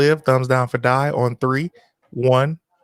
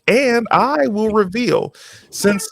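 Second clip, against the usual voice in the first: dramatic reveal; 8 semitones higher, slower than usual. Acted. Dramatic game host reveal.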